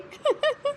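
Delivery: quick high burst of laughter; short mocking cackle. Mother-in-law laughs again at the bahu. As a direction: snort a laugh at her. Saas mocks dance plan.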